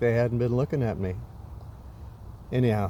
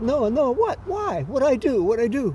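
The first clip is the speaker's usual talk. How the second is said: shouting up; 9 semitones higher than usual. Retold or imitated. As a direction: call up desperately to Noah. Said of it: "I shout up".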